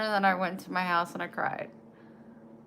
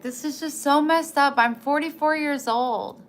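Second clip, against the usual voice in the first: higher, louder indignant protest; indignant outburst; 8 semitones higher, 8 dB louder than usual. Retold or imitated. Retelling arguing with her parents about adopting kittens, indignant. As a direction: push back hard, fed up. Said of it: "I was like".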